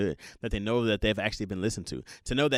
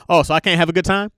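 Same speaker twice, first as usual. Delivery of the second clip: defensive snap; 6 semitones higher, 9 dB louder than usual. Retold or imitated. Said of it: "mock argument".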